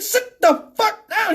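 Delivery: high-pitched, slow, emphatic order; forceful cop command. Acted. Cop ordering the passenger to sit, high and forceful. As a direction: draw out each word as a command.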